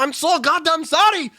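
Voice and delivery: high, hammed-up German accent; attempted German accent. Friend attempting the German worker's apology, high German accent. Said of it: "I don't know how to do a German accent".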